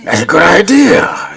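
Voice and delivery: high, loud and raspy. Acted. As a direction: rasp it out like an old mobster. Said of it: "that raspy voice".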